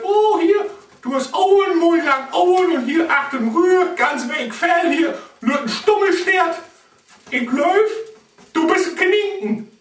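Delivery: high squeaky snake discovering; excited little snake voice, high. Acted. Snake feels the rabbit.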